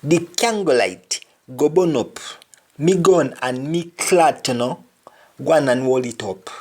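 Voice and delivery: Jamaican patois again. Shown as a demonstration. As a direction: repeat the line in Jamaican patois. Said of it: "For example".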